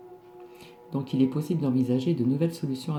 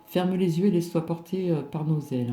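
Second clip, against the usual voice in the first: higher, louder card reading voice; right in your ear; 4 semitones higher, 5 dB louder than usual. Acted. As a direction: proclaim the fairy card's message. Rereading the fairy card.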